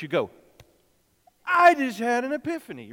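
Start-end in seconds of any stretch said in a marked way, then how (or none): 1.4-2.9 loud, high, thrilled cry; big excited demo shout. Shown as a demonstration. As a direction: show the kids how to shout it with joy. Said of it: "you go like this first".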